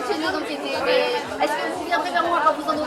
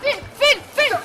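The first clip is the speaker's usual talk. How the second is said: excited shouting; 8 semitones higher, 4 dB louder than usual. Acted. Reporter during live arrest.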